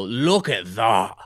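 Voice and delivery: drawn-out imitation Scottish accent. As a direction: do a Scot admiring a good shot, drawn out. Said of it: "how to speak a Scottish accent".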